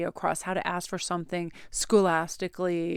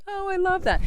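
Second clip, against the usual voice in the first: much higher, louder squeal of delight; gushing delight; 13 semitones higher, 5 dB louder than usual. Retold or imitated. Spotting Christmas headgear in the store, squealing in delight. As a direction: squeal over a cute find.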